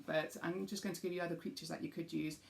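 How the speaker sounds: ordinary talk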